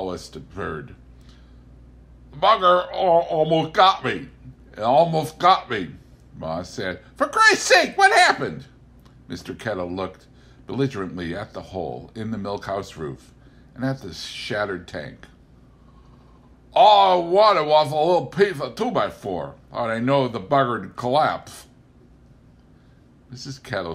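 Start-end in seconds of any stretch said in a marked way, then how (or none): 2.3-5.9 put-on higher, louder rustic old man; rattled old farmer voice; Pa still shaken after the tower fell; Pa Kettle voice. A narrator's reading. 7.1-8.7 put-on very high, loud shrieking old woman; exasperated old farm wife; Ma demanding what happened, high and loud; Ma Kettle voice. A narrator's reading. 16.7-21.7 put-on higher, louder defensive old farmer; Pa excusing himself to Ma; Pa Kettle voice. A narrator's reading.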